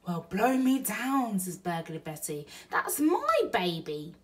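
amazed Betty. Acted.

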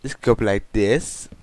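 ordinary talk

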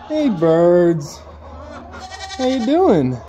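high, sing-song voice for the birds; pet voice. Real, not acted. Farmer greets her ducks in a high baby-talk voice. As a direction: coo at the animals like they're babies.

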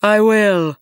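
loud, drawn-out storybook declaration; imposing giant. A narrator's reading. Giant agreeing to the fisherman's question, imposing. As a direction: boom out the consent.

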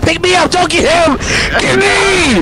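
panicking gamer. Real, not acted. Downed player begging revive.

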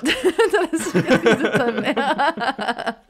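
répété lentement en éclatant de rire; en riant. Real, not acted. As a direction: dit en riant, plus haut et plus lent. Répète la blague en riant. (en pleine rigolade)

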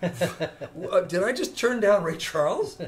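high, loud, laughing through the words; laughing disbelief. Real, not acted. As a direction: crack up at your own mistake. Laughing at his own blunder.

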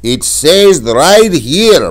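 slow, loud, huffy insistence; a proud man defending his reading. Retold or imitated. Father insisting to his son he read it right, slow huffy insistence. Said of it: "a little huffy".

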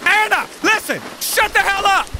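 high, exasperated yelling; scolding shout. Real, not acted. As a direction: tell her off at full voice. Scolding the raging monster.

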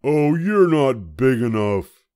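high, loud, grumpy little bug; a tiny bug spoiling for a fight, high and loud; grouchy ladybug. A narrator's reading. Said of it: "said the grouchy ladybug".

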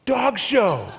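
second "Dog show." sold harder; emphatic repeat. Acted. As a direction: announce "Dog show." with a punch.

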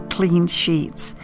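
ordinary delivery

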